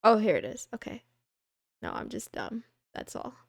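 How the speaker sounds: ordinary talk, nothing marked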